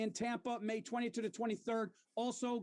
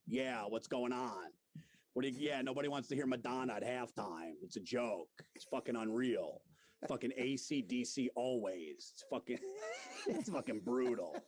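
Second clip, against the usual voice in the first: much lower, gruff rant with laughs breaking in; Florentine impression; 10 semitones lower than usual. Host does Florentine for his co-host, low grumbling rant. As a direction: grumble about the halftime show like a grouchy radio guy. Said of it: "I could do a good Florentine".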